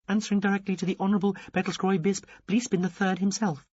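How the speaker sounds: high, prim alien officer voice; a high, dutiful little alien. A narrator's reading.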